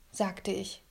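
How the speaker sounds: usual speaking voice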